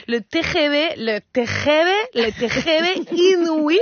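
put-on Spanish accent, "T G V" with throaty j-like G; struggling Spanish pronunciation. Lola fighting French words, "T G V" in a struggling Spanish accent. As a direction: stumble over "T G V" in a thick Spanish accent. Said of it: "que c'est difficile à dire".

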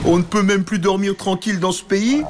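irritated dragon grumble; grumpy dragon. Acted. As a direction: complain about lost sleep.

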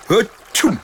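high, loud dragon sneeze. A narrator's reading. Dragon sneezing as tears douse fire, loud sneeze. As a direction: sneeze out the flames. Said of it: "Le dragon éternua".

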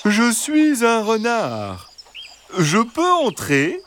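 high, slow and soothing fox; gentle fox voice. Acted. Fox asking the hens to let him in, high and gentle. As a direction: coax them sweetly, slowly, like a friendly fox. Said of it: "Une voix douce et rassurante".

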